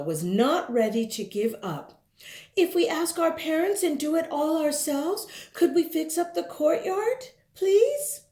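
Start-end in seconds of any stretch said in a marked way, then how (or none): 2.5-8.2 put-on high, pleading kid voice; eager child reading letter. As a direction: read the letter like a hopeful child.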